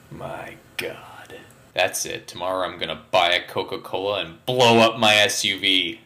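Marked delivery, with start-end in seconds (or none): 0.1-1.3 breathy mock-horrified whisper. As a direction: gasp it out under your breath. Mock horror at lost polar bears.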